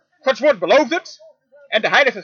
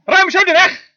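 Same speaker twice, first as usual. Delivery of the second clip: "Ruim zo de weg" again, pitched higher and loud; higher repeated command; 8 semitones higher, 6 dB louder than usual. Acted. Preacher repeating God's order to his flock, rising and loud. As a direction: lift it up and ring it out.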